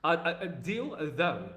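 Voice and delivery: "deal" held apart from a dropping "though"; careful enunciation. Shown as a demonstration. East Londoner checking his accent, separating "deal" from "though". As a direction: test out "deal" and "though" as two distinct words. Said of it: "It's my English accent".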